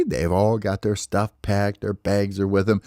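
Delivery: usual speaking voice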